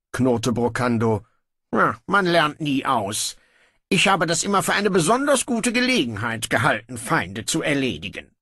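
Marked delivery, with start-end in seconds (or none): 1.7-8.3 higher, breezy royal voice; a lazy king giving in; offhand young king. Acted. Brocando grudgingly orders guards to release his brother, breezy.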